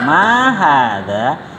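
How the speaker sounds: MA stretched and arched, HADZA drawn out to a rise; very slow question tune on "Ma hadza"; question intonation. Shown as a demonstration. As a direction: model "Ma hadza" as a long, lilting question. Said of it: "Jadi intonasinya seperti itu".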